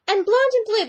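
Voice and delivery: high, loud mocking singsong of a rival; mock her smug claim, high and loud; mocking whiny imitation. Vlogger mocking a rival's possessive comment, high loud mock singsong. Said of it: "she was like".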